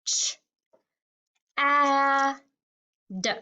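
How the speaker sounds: "Ch, a, d" with a pause after each sound. Shown as a demonstration. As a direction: hold "a", keep "Ch" and "d" short. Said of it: "One more time".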